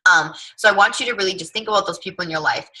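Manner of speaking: ordinary talk, nothing marked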